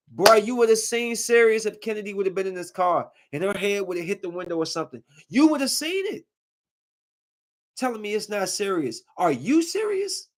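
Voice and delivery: high, incredulous indignation; outraged retelling of the confrontation with the driver; indignant outburst. Retold or imitated. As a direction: throw it back at him in disbelief.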